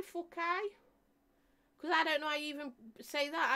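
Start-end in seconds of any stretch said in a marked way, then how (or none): 0.0-0.7 "Fukai" as foo-KYE, pitched high; saying Fukai. Shown as a demonstration. Showing viewers how she says the brand name. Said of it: "heard me say Fukai".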